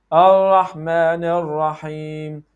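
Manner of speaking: 'Ar-Rahman Ar-Rahim' slow, R held without touching; hanging tongue R. Shown as a demonstration. Teacher showing common mistake, untapped hanging R. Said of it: "hang the tongue without touching".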